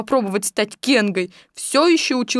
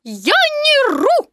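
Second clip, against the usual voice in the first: high, slow squealed piglet protest; loud protest; 4 dB louder, much slower than usual. A narrator's reading. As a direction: squeal each word out emphatically. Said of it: "громко сказал Пятачок". (громкий писклявый протест)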